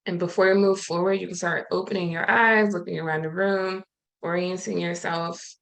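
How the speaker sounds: calm, unhurried breathing guidance with long pauses; guided meditation. Acted. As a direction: gently talk the group into stillness and breath.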